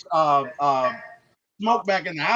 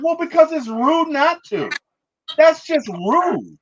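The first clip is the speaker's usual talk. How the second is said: higher, louder, offended insistence; indignant about manners; 8 semitones higher, 4 dB louder than usual. Retold or imitated. Cornbread scolds them for being rude, mock indignant. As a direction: stretch out the last bit like an affronted guest.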